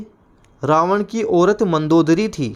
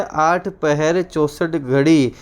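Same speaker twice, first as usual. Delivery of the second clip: slow recitation; much slower than usual. Shown as a demonstration. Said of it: "idiom repeated slowly".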